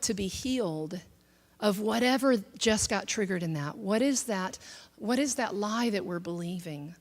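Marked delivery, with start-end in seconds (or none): none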